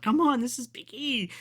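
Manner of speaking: high-pitched, indignant urging; exasperated fan plea. Retold or imitated. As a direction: plead with an unresponsive crowd, pitch way up. Re-enacts urging a quiet crowd.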